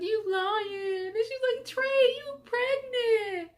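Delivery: high crying voice, slow on the last words; crying sister. Retold or imitated. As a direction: sister tearfully accusing.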